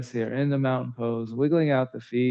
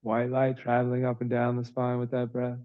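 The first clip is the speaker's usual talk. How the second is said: calm, even yoga-teacher voice with long pauses; soothing, evenly paced breath cues; guided breathing meditation; 2 semitones lower, 3 dB quieter than usual. Real, not acted. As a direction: guide the breath gently, in an unhurried monotone.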